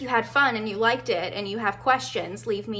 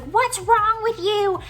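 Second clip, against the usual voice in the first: high, slow puzzled friend voice; mock the baffled best friend; friend's reaction; 12 semitones higher, 6 dB louder, slower than usual. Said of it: "she was like".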